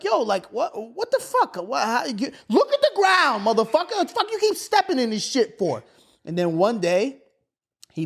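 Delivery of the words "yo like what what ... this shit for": high-pitched, incredulous challenge; retold exasperation. Son questioning stepdad about the mess, exasperated. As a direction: demand answers in mock outrage.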